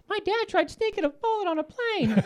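high mocking kid voice; sing-song taunt like a teenage daughter; teasing daughter. Dad imitates daughter teasing him about the bullet. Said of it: "My daughter didn't let me live it down".

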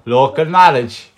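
"Local knowledge" slow and drawn out; spelled-out slowly. Shown as a demonstration. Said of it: "It's for the dyslexic people".

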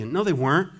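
ordinary delivery

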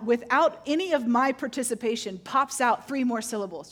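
ordinary talk

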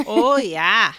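loud, hammy Scottish burr; mock Scottish greeting; bad Scottish accent. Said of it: "that turned into some bad Scottish accent".

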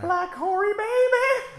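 mock yell. Real, not acted. Mocking insult shouted at screen.

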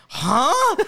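high, loud questioning yelp; baffled double take. Acted. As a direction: stop dead and blurt it in disbelief. Acts stopping in confusion.

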